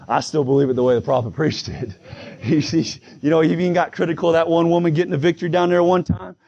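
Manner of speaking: usual speaking voice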